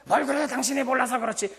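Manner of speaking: dismissive spouse. Retold or imitated. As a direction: snap back at the partner with contempt. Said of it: "이것이 아니라".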